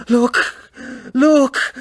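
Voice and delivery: high, loud frightened cry; shrieking. Acted. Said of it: "she shrieked".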